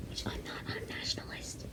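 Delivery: hushed whispered aside; whispering. Real, not acted. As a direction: whisper it like a secret. Secret aside to viewers.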